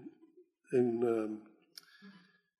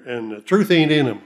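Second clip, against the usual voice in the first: punchy, louder imitated Oklahoma accent; 7 dB louder than usual. Preacher quoting a brother from Oklahoma City, imitated Oklahoma accent. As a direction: imitate an Oklahoma twang, landing it firmly. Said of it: "That was his Oklahoma accent".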